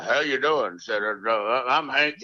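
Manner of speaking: lightly imitated low movie-cowboy drawl; a hint of a deep Western hero; John Wayne. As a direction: drop the voice a little for a mock-macho introduction. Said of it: "He sounded like John Wayne".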